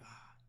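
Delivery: soft, breathy gasp of realization; a hushed ah as it dawns on him; whispered gasp of sudden insight. Retold or imitated. Sudden realization, remembering pastor.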